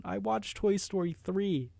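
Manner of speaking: high, slow mock-tearful voice; drawn-out, squeezed fake whimper; tearful confession. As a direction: imitate confessing through tears. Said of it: "like, holding back tears".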